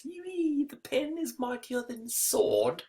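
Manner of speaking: very high, soft silly voice; high-pitched mock declaimer; hammed-up proclamation. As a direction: squeak out the proverb slowly, mock-grandly. Hammed-up proverb about war of words.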